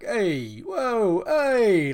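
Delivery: hammed-up New York mafia voice, rising high and loud; mock Italian-American mafia. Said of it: "so they all talk like".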